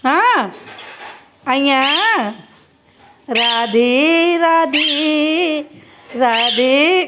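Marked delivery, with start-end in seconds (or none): 3.3-7.1 slow drawn-out Radhe. Shown as a demonstration. Teaching the parrot to talk.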